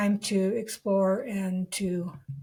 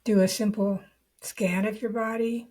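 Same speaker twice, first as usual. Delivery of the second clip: guided meditation. Real, not acted. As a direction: lead the listener unhurriedly into the body, leaving long silences.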